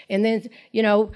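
normal talking voice

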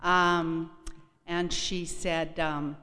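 ordinary talk, nothing marked

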